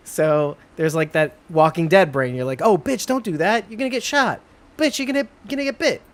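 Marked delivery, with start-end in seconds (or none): 2.6-6.0 high-pitched, hammed-up viewer panic; playful high-voiced heckling; mock alarmed warning. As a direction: warn a screen character in a squeaky rush of disbelief. Voicing a viewer yelling at characters.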